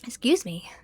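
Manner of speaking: ordinary talk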